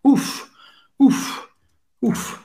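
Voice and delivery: short puffed exhalations on "ouf"; relieved. Shown as a demonstration. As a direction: blow out the breath in relief. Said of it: "expressar um alívio". (aliviado, soprando "ouf")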